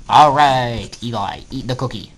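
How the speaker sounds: ordinary delivery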